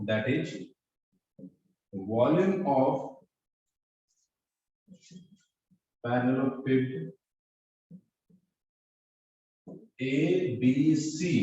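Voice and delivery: very slow, word by word, for note-taking; slow dictation for students copying notes. Shown as a demonstration. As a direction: spell it out slowly so students can write. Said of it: "note करो sir".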